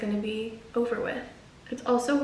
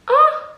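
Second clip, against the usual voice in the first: 1 pain yelp; 7 dB louder than usual. Real, not acted. Needle goes through her ear.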